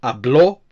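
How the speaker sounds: habló with a rising, weighted ending; final-syllable stress. Shown as a demonstration. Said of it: "again with the stress on the O".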